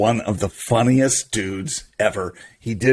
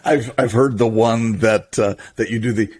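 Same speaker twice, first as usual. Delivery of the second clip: usual speaking voice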